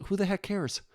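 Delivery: a hint of a snotty rival; mock dismissive streamer. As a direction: toss it off with mock disdain. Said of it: "even Queso is like".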